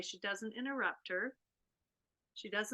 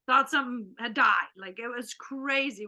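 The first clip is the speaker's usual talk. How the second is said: normal talking voice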